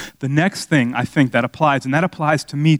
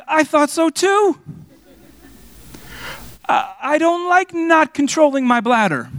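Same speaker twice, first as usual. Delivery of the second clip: high mock whine. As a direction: squeak it out in mock distress. Joking about his bladder.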